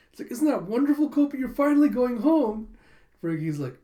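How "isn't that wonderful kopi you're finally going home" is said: high, loud gushing delight; overjoyed acted quote. Rescuer celebrates the cat going home, overjoyed. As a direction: gush at a rescued pet, pitched high.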